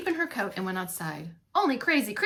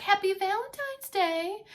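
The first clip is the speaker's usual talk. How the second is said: sing-song; 10 semitones higher, slower than usual. Acted. Coaxing husband awake.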